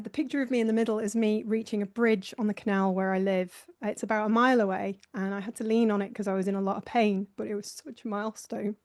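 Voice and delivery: normal talking voice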